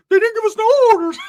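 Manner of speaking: high, loud, fast excuse; Sheila's high voice. Retold or imitated. Sheila lying about the missing fries in a high, loud voice. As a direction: squeak the lie out quickly. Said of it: "She was like".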